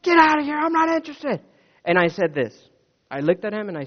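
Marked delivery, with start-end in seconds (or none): 0.0-1.4 loud, high yell of an irate man; acted-out furious shouting, pitched way up; imitated angry screaming. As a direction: mimic a man screaming you away from his door. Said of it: "he was screaming at me".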